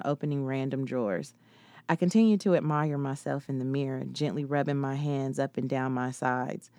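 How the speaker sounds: ordinary delivery, nothing marked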